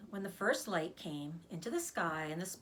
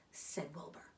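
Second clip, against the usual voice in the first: usual speaking voice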